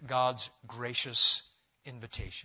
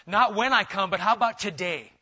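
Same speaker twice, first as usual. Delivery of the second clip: voicing Jesus; 5 semitones higher, 7 dB louder than usual. Jesus voiced answering the thief.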